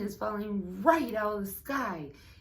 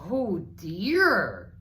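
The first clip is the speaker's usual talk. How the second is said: dismayed donkey; 3 semitones higher, 9 dB louder than usual. A narrator's reading. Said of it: "cried the donkey".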